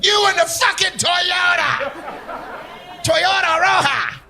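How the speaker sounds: high, slow shouting traffic cop; slow, high mock yell at a driver; imitated yelling. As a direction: bellow it across the intersection. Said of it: "She's out there yelling".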